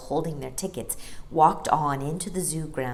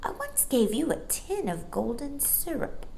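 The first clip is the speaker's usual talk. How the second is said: put-on high, eager boy voice; young boy voice; 9 semitones higher, 4 dB quieter than usual. A narrator's reading. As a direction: speak up brightly like a small boy. Michael's voice in story.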